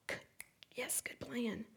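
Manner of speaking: soft unvoiced aside; sarcastic whisper; whispered wry aside. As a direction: whisper it with a dry smile.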